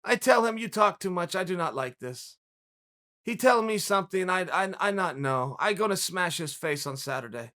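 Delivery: low, quiet imitation of a foreign fighter; Chimaev. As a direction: imitate a fighter's broken English, low and soft. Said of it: "Chimaev was playing the role".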